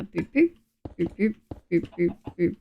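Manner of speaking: quiet little boop sound effects; soft boops. Real, not acted. Streamer placing items on screen, soft absent-minded boops. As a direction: boop softly to yourself. Placing things with made-up noises.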